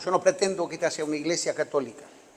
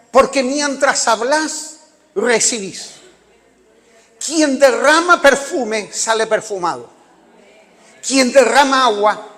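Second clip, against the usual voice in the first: fervent proclaiming; 4 semitones higher, 6 dB louder than usual. Acted. Preacher proclaims a saying.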